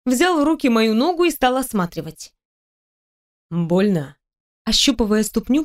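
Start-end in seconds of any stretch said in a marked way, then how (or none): 3.5-4.2 low-pitched voice for the boss; boss inquiring. A narrator's reading. Boss feeling her hurt foot, low inquiry. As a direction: ask it low, with concern for the injury. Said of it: "поинтересовался он".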